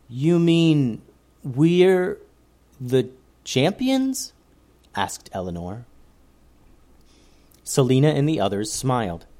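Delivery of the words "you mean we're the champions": high, slowed disbelief; amazed. A narrator's reading. Eleanor asking the leader, amazed and slow. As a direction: savour the realisation.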